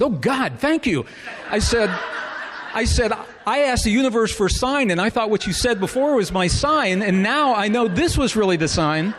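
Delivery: high, loud delighted thanks; elated. Retold or imitated. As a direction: burst with joy at the sign. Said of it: "gleeful reply to the threat".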